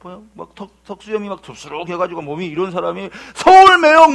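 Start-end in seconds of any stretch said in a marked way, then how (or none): 3.3-4.1 loud, high, drawn-out holler; imitated stranger's shout; calling out. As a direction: hail someone from behind. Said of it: "막 부르는 거야".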